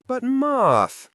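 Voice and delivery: put-on high, loud child's whine; boy's loud high protest at his teacher; whiny protest. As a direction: plead loudly like a boy sent outside. Child protests being sent out.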